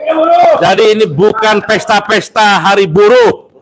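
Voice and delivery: loud high rally cry; fiery revolutionary speech. Real, not acted. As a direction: shout it over the crowd. Rally speech repeated louder.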